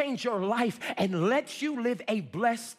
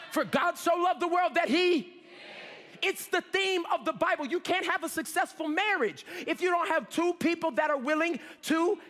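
high, ringing preacher delivery; impassioned preaching; 8 semitones higher than usual. Real, not acted. Preacher declaring giving the Bible's theme, impassioned. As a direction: preach with urgency, stretching the key words.

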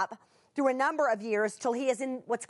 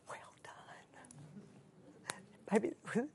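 whispered tender praise; 12 dB quieter than usual. Acted. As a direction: breathe the words of praise.

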